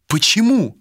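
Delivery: high, startled demand for a reason; indignant challenge. Acted. Malika challenging Vilar's ban on the trip, indignant.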